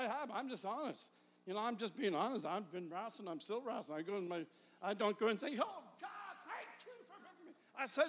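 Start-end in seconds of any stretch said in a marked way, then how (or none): 5.6-7.5 high, hushed fake-grateful squeal; mock gushing prayer. Preacher parodying a thankful prayer he never prays, mock gushing. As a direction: put on a tiny, swooning thankful voice.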